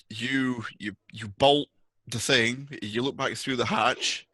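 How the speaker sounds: ordinary talk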